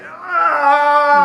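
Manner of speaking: loud, high, drawn-out "aah"; falling scream. Acted. Student lets go of the test, long mock falling scream. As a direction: cry out as if plummeting.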